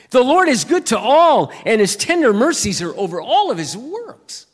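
loud, high proclamation of the psalm; proclaiming scripture. Acted. Preacher to congregation, a loud, high proclamation. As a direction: ring it out like a preacher reading scripture.

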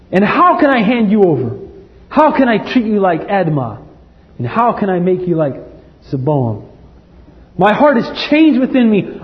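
rising, impassioned Bible reading; preacher reading scripture with feeling. Acted. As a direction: read it like a plea. Preacher reads Hosea aloud.

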